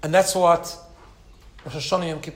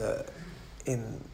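ordinary delivery

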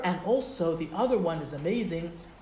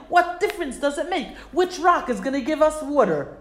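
higher and louder, impatient demand; mock angry crowd; 6 semitones higher, 4 dB louder than usual. The people demanding water from Moshe, mock angry. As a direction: yell it like a thirsty, impatient crowd. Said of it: "they start to scream at Moshe".

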